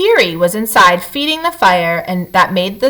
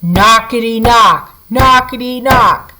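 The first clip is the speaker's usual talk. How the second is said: thunderous knocking; 4 dB louder than usual. A narrator's reading. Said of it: "a thunderous knockety".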